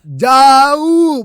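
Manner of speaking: put-on long, loud Ambonese-accented call. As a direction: belt it out high with an Ambonese twang. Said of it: "Pakai logat Ambon".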